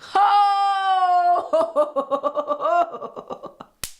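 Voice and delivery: loud high cackling laugh; shrieking laugh. Real, not acted. Viewer cracking up at a cheesy line, loud shrieking laugh. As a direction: burst into a screaming laugh.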